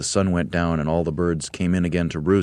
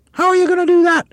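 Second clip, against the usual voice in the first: very high, louder shrieky hag voice; Missus Twit; 10 dB louder, faster than usual. Acted. Missus Twit squawking at her husband. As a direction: squawk like a harridan.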